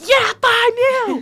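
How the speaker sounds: high, loud, drawn-out yell; slow, stretched team holler; loudest cheer. Retold or imitated. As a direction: imitate being the loudest fans there. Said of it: "loudest there".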